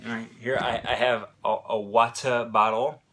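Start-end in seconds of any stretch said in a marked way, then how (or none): 1.8-3.0 water bottle with true T's and no R in "water"; British T. Shown as a demonstration. Teacher imitates England's pronunciation, "water bottle" with real T's. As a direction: pronounce each T fully in "water bottle", English style. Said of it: "they actually pronounce that T".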